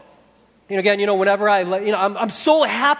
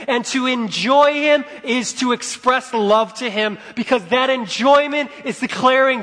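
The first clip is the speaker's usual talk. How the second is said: loud, high, mounting proclamation; preaching at full force; 6 semitones higher, 7 dB louder than usual. Real, not acted. Preacher declaring Jesus worthy, impassioned preaching. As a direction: drive it up to a climax.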